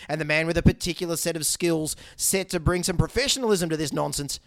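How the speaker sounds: ordinary delivery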